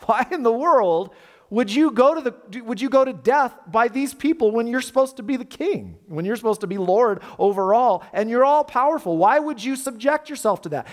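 usual speaking voice

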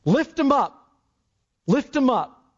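high, emphatic repeated cry; crowd's cry. Acted. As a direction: call it out as the demanding mob. Acting the crowd's cries.

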